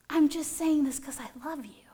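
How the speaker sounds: whispered, wounded explanation; hurt whisper. Acted. Hurt Peter explains himself.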